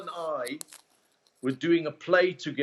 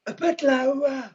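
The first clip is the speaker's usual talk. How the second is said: slow high put-on voice; 9 semitones higher than usual. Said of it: "he went like".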